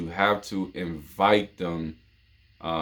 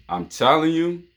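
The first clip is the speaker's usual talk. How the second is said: ordinary talk, nothing marked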